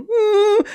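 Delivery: high cowering whimper; mock whimper. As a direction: whimper like a scared wimp. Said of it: "he wasn't sitting there going".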